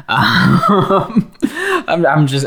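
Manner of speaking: ordinary delivery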